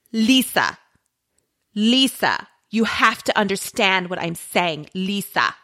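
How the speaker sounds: mock affected accent, names set apart by pauses; exaggerated, affected accent; Dorit Kemsley. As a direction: imitate a reality star's put-on accent. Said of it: "But she has this, like, accent".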